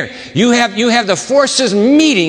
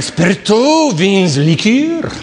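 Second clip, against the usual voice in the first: drawn-out French, pitched up; French pronunciation; slower than usual. Acted. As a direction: read the sign out with a French accent. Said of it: "Even in French".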